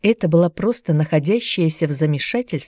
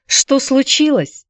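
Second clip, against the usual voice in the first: slow, high, menacing echo; threatening; 7 semitones higher, slower than usual. A narrator's reading. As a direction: throw the question back as a threat. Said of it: "с угрозой переспросила тётка".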